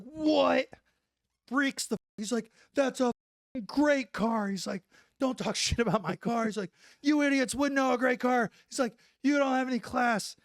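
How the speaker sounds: high, loud offended protest; indignant outburst. Retold or imitated. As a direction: defend your car, outraged. Tillman hits the roof.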